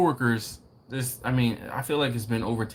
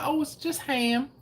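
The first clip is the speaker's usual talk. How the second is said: high-pitched mock waitress; imitating waitress. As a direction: put on a much higher voice for the waitress. Retold waitress's answer in dialogue.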